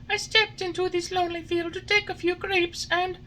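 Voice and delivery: put-on high puppet boy voice, pleading; a small wooden boy begging to be freed; Pinocchio. A narrator's reading.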